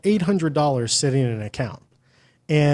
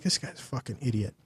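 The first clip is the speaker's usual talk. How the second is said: muttered insult; 12 dB quieter than usual. Retold or imitated. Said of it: "I'm like".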